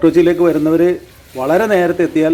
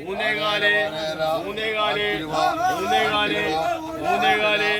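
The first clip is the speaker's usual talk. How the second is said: higher repeated bid calls; auctioneer; 5 semitones higher, 8 dB quieter, slower than usual. Real, not acted. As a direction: call the price again and again. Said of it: "ഈ ലേലം വിളിക്കുന്ന ആള്".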